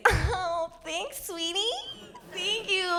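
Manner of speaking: put-on high-pitched drunken thanks, over-familiar; imitating her tipsy self; mock tipsy gushing. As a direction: coo it high like you've had too many.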